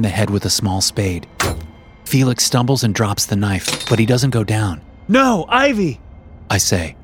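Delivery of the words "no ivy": high, loud cry of alarm; shout. A narrator's reading. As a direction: shout to stop her. Stopping Ivy hitting brother.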